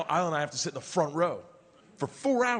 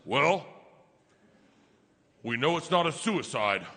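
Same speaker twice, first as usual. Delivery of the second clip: lower, sure-footed mock deduction; very confident; 4 semitones lower than usual. Daughter explains the gunshots to family, very confident. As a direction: announce it with total certainty. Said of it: "very confidently and goes".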